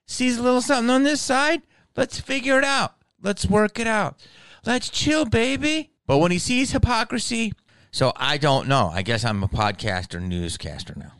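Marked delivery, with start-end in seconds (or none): 0.1-1.6 much higher, faster promo; mock promo voice. Repeating his promo pitch, higher and faster. 2.0-7.5 higher, hammed-up promo; mock promo voice. Promoting himself as the centrist, hammed-up promo.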